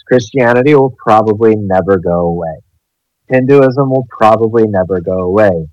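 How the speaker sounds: normal talking voice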